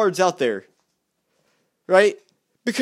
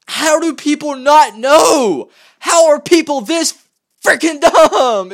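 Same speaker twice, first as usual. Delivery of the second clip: higher, louder and slow, fed-up yell; exasperated shout; 8 semitones higher, 8 dB louder, slower than usual. Real, not acted. Ranting to viewers, a real frustrated outcry. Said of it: "This is what's really frustrating".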